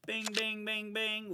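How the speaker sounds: high, loud "bing, bing, bing, bing" like a game-show bell; excited bing bing. Real, not acted. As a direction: ring out the win like a buzzer. Announcing a winning move.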